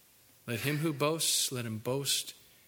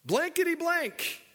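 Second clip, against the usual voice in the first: high, hammed-up swearing denial; fake-curse it in a high comic voice; Peter cursing; 9 semitones higher than usual. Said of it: "he began to curse and swear".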